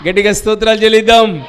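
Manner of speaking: loud, high, fast call to praise; preacher urging loud praise; rallying call. Real, not acted. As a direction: fire up the congregation. Preacher rallies congregation.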